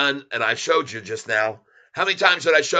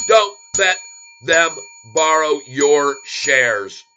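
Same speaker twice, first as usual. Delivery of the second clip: loud and punched, word by word; emphatic urging; 6 dB louder, slower than usual. Real, not acted. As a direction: drive the warning home to viewers. Urging viewers against lending shares.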